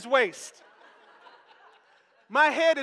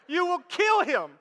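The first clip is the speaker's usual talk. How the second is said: higher-pitched, emphatic warning in one run; 5 semitones higher, 4 dB louder than usual. Retold or imitated. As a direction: warn him urgently without stretching the words. Repeats the warning more quickly.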